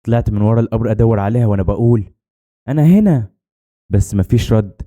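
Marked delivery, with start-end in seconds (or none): 2.7-3.3 higher-pitched call to a friend. A narrator's reading. Girl answers her friend among the graves, calling out. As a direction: call out to someone searching for you.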